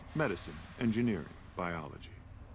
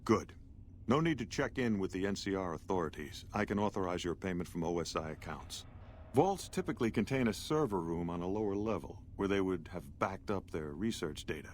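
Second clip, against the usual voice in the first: ordinary talk, nothing marked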